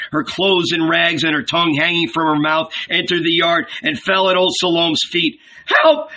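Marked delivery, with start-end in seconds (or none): 5.7-6.1 high panicked cry for help; frightened cry. A narrator's reading. Fleeing woman begs old Salome, crying out in terror. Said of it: "she cried".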